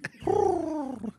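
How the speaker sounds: high, drawn-out bird coo; gentle cooing. Acted. The bird answering honey, gentle high coo.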